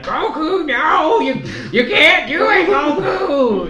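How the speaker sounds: high, loud, laughing plea; imitating Supreme Kai. Supreme Kai begging Goku to stay back, high and loud. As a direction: squeal a frantic plea through laughter. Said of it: "and Supreme Kai's like".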